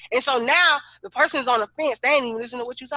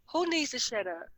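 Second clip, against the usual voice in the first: soft, incredulous question; pre-anger check; 8 dB quieter than usual. Acted. Checking who was told to shut up, quietly menacing. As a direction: ask it dangerously quietly. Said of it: "before I get pissed off".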